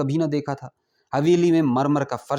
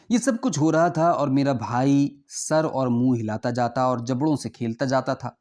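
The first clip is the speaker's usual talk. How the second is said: usual speaking voice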